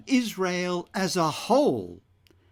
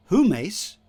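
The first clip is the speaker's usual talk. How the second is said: humes said with emphasis, higher and louder; emphatic Greek pronoun; 5 semitones higher, 5 dB louder than usual. Shown as a demonstration. Preacher explains the Greek pronoun, stressing humes. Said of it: "is emphatic".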